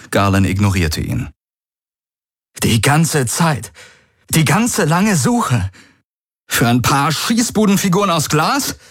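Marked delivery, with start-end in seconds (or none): 2.6-8.8 voice derailing. A narrator's reading. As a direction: let the voice climb until it breaks. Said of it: "Die Stimme entgleiste ihm".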